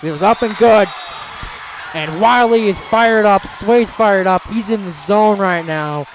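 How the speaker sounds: excited sports commentary; fired-up play-by-play. Real, not acted. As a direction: celebrate the made shot on air. Live play-by-play.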